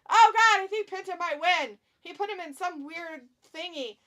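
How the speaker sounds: loud, high match reaction; alarmed excitement. Real, not acted. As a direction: cry out as the pin looks likely.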